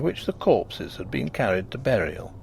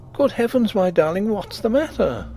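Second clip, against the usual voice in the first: startled high cry of concern; alarmed; 8 semitones higher than usual. A narrator's reading. Husband finding his wife weeping, alarmed.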